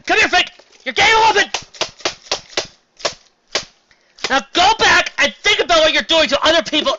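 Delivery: very high, loud outraged yelling; mock scolding tirade. Reviewer scolds the author Frank, very high loud yelling. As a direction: yell at him like a furious parent.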